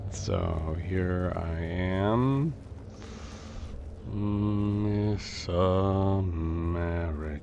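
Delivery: loud, very slow, drawn-out words; mock-grand drawl. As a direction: stretch it out loudly, like a mock announcement.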